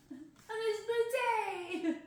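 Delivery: slow, giggly tag line; giggling. Real, not acted. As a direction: laugh the punchline out. Giggling after reading the line.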